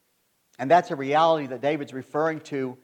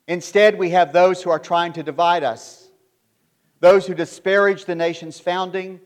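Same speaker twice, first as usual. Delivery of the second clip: fiery preaching; 3 semitones higher, 4 dB louder than usual. Acted. Preacher denouncing divisive leaders, rising into fiery louder preaching. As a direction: thunder it against the nation's enemies.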